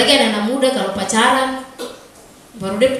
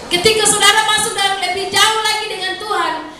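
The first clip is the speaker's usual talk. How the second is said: much higher, louder impassioned preaching; preaching at full force; 11 semitones higher, 4 dB louder than usual. Acted. Pastor urging worshippers to long for God, impassioned. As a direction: cry out to the people with fervour.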